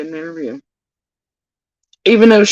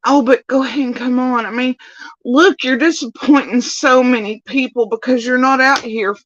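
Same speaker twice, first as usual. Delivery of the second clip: mock manipulative plea; 8 semitones higher than usual. Imitating Ziggy guilt-tripping a guest to appear, in a higher whiny voice. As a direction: whine as if pressuring a guest. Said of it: "it's a manipulation technique".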